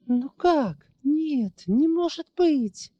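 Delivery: high, hoarse horrified disbelief. Acted. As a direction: gasp it out in horror. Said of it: "с ужасом прохрипела Ларисинья".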